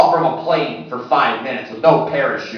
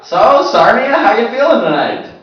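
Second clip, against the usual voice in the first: crowd greeting; 8 semitones higher, 5 dB louder than usual. Real, not acted.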